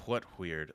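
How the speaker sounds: "What weird" with an exaggerated hw on both words; odd pronunciation. Real, not acted. Host teased about his speech, over-aspirated "What weird". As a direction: puff an h before each w in "What weird". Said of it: "Why am I saying that".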